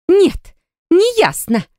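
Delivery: high, drawn-out furious retort; furious flare-up. A narrator's reading. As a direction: flare up at him, slow and high. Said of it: "вскинулась эта бешеная". (вспыхнув от бешенства)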